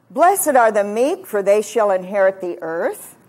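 loud, measured pulpit reading; scripture proclamation. Acted. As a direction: proclaim the verse loudly and deliberately.

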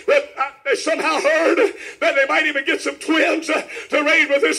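rhythmic, emphatic proclamation; revival preaching. Real, not acted. Preacher pointing to the couple's child, rhythmic preaching. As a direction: preach it as living proof, phrase by phrase.

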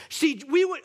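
rising, loud preacher's cry; preaching at full force. Real, not acted. Pastor breaking into full preaching mode. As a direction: let the sermon take off. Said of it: "I'm gonna preach for a second".

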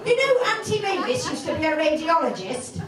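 high-pitched, mock-anxious mother voice; fretting mother. Mother texts daughter about a broken bone, fretting. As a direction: rattle on in a high, worried pitch.

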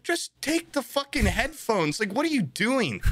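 incredulous at the guy; mock exasperation with a seatmate; exasperated.

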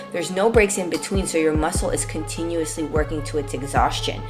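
normal talking voice

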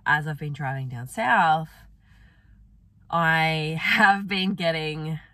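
sore-throat hoarse voice. Real, not acted. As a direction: speak through a swollen throat. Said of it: "I don't know if you can hear it in my voice".

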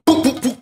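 very high playful boop boop boop; high cartoon boops. Mimicking the crash in story.

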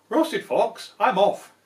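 hammed-up panic; panicked fox. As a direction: bolt in a fright.

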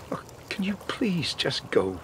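hushed, breathless plea; pleading whisper. Acted. Grieving husband begging the detectives to leave, whispered. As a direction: whisper it, worn out by grief.

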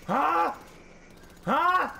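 loud, high taunting "huh"; loud high mocking taunt. Real, not acted. Taunting friend in game.

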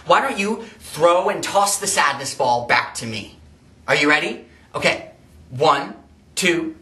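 ordinary talk

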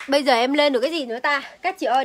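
normal talking voice

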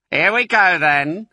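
high, loud battle call; rousing shout over machinery. A narrator's reading. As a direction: shout it out to start the charge. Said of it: "shouted Bean".

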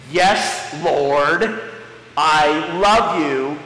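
slow, grudging, dragged-out "I love you"; forced apology. Shown as a demonstration. The cow answers Jesus like a forced apology, slow and grudging. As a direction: say it like a kid forced to apologize to his brother. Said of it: "say it like that".

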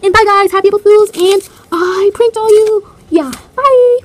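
loud, high, gleeful farewell; big drawn-out gotcha send-off; excited sign-off. Real, not acted. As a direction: shout a triumphant goodbye to viewers. Excited sign-off after prank reveal.

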